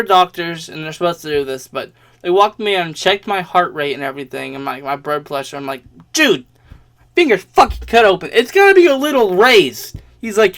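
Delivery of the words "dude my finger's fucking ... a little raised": high, loud exasperated comeback; incredulous retort. Retold or imitated. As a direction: replay an outraged protest at full volume. Retold incredulous retort about heart rate.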